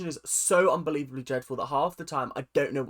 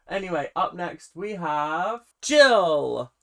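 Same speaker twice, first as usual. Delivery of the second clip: mock host announcement; 3 semitones higher, 6 dB louder, slower than usual. Mock announcement, then just joking.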